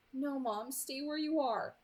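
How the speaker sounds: ordinary delivery, nothing marked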